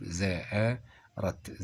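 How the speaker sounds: زاء رت split apart, very slow, the zaa held long; syllable by syllable. Shown as a demonstration. Teacher sounds out the hamza for students, very slow. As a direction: break زأرت into two slow syllables. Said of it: "لازم النطق مهم جدًا".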